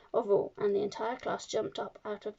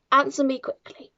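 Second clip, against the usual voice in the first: sharp demand; 4 semitones higher, 7 dB louder than usual. A narrator's reading. Headmistress demanding an answer.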